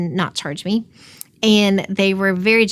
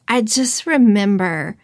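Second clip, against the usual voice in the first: normal talking voice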